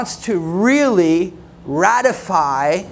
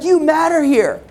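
higher, sugary reassurance; earnest church greeter; 7 semitones higher than usual. Retold or imitated. Greeter assuring the newcomer he matters, higher and sugary. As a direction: lay the welcome on thick. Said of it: "They're like".

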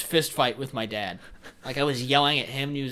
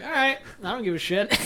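laughing; 9 semitones higher, 4 dB louder than usual. Retold or imitated. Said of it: "I was like".

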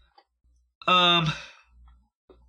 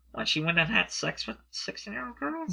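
high, soft disbelief; incredulous question; 10 semitones higher, 6 dB quieter than usual. Real, not acted. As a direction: ask it in shocked disbelief, pitch way up. Shocked reaction to the Epstein rumour.

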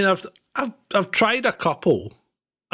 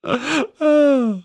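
laugh; 9 semitones higher than usual. Real, not acted. Cohost cracks up at him.

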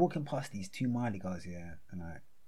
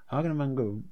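normal talking voice

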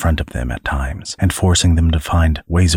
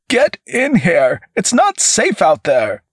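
high-pitched, urgent command; urgent order; 12 semitones higher than usual. A narrator's reading. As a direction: call them in urgently, voice pitched way up. Armed clerk orders kids inside.

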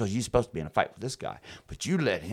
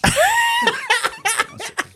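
much higher, loud laughing through the words; loud laughing shout; 9 dB louder than usual. Real, not acted. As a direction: laugh out loud while talking. Laughing hard mid-story.

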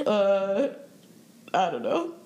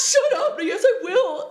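laughing quote; 12 semitones higher, 4 dB louder, slower than usual. Retold or imitated. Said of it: "And then he goes".

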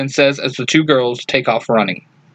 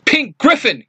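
shout; 10 semitones higher than usual. A narrator's reading. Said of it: "Kim shouts".

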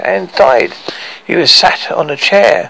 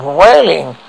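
ordinary delivery

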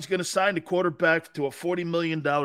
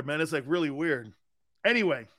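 normal talking voice

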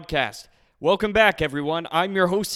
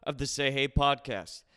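podcast intro read; 4 dB quieter than usual. Acted.

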